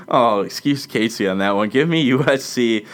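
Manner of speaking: normal talking voice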